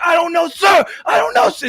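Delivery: high, full-volume mocking yell; imitated screaming. Drill instructor screaming at a recruit, imitated screaming. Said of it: "just, like, screaming, dude, just screaming".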